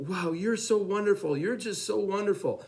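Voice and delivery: high, gushing praise; retold gushing exclamation, pitched well up; exclaiming in admiration.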